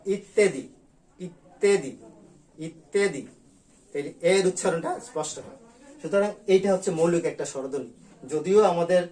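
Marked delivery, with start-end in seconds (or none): 0.0-3.2 ইত্যাদি said with an open এ start; teacher modelling the right way; correct pronunciation. Shown as a demonstration. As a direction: say ইত্যাদি opening with এ, carefully. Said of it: "বলতে হবে".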